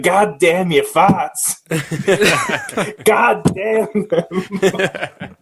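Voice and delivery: put-on sea-dog accent, slow and high, breaking into laughter; Willem Dafoe's accent. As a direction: stretch each word in a salty old seaman's accent, laughing through it. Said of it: "he has this accent".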